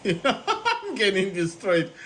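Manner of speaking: slow, laughing through the words; amused at losing badly. Real, not acted. Same words said twice, laughing.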